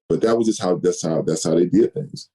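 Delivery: normal talking voice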